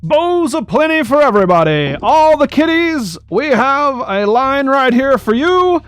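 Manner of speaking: carnival barker. Acted. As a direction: call out to passersby like a barker. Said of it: "he is yelling to the various passenger passing bys".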